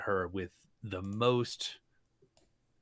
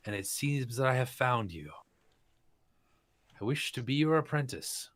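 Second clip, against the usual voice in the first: flowery greeting; 5 semitones higher than usual. Acted. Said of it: "Meng bows and says".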